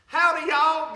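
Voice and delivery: loud, high Southern holler; hearty Southern greeting. Shown as a demonstration. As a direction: belt out a big Southern hello. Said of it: "give me a good Southern".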